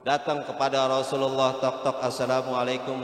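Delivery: low, hushed storytelling; soft story narration. Acted. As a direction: draw listeners in, low and gentle. Quiet storytelling of the Prophet. (kisah dituturkan pelan dan lembut)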